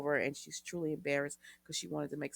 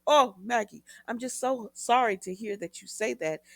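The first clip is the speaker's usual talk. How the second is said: mock regret; 5 semitones higher, 6 dB louder than usual. Mocks Constantine's feigned regret.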